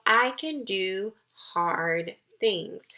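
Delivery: slow, deliberate affirmation. Shown as a demonstration. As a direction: say each word slowly like a promise to yourself. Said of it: "every morning you say to yourself".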